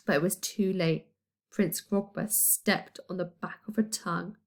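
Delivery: ordinary delivery, nothing marked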